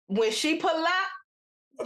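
very slow, each word stretched; drawn out. Real, not acted. Teller hyping up the aunt's arrival, drawn out. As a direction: draw out PULL UP for effect.

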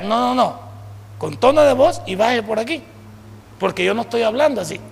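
ordinary talk

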